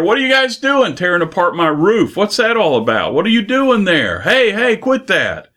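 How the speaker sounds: high-pitched mock protest from below; hammed-up indignant homeowner yelling up, pitch climbing. House owner yells up at men tearing open his roof, high mock yell. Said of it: "The house owner is yelling up there".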